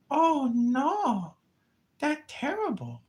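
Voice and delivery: high, whiny immigrant tenant voice; mock tenant voice. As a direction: whine like a fretful tenant. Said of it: "She's like".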